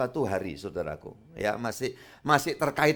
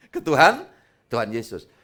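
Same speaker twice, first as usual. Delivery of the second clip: laughing; 9 dB louder than usual. Real, not acted. Laughing answer to own question.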